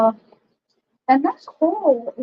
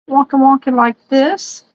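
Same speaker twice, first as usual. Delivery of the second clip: ordinary delivery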